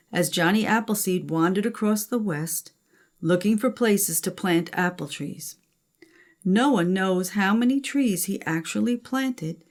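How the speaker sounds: usual speaking voice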